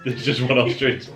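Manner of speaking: ordinary delivery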